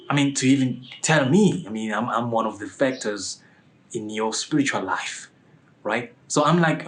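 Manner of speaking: ordinary talk, nothing marked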